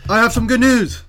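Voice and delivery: high and loud announcement; excited. Real, not acted. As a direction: burst out with the good news. Announcing news.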